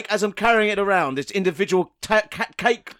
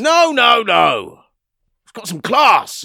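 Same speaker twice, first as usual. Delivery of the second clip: loud mock protest; 3 semitones higher, 7 dB louder, slower than usual. Tycoon rejects name loudly.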